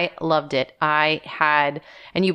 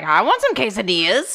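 excited retold plea, swooping up in pitch; eager outburst; 3 semitones higher than usual. Wife retells answering husband's quesadilla offer, eager outburst. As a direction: pipe up eagerly, pitch leaping.

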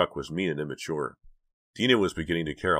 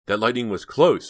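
shaky voice; 6 semitones higher, 8 dB louder than usual. A narrator's reading. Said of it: "in a shaky voice".

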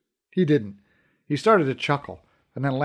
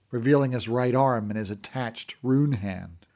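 plain reading voice

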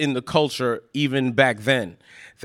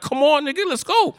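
urgent. Retold or imitated. Friend urging escape from shootout.

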